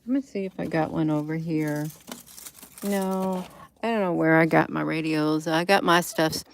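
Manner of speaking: normal talking voice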